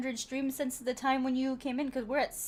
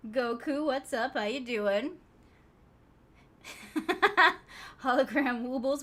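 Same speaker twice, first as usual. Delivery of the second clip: normal talking voice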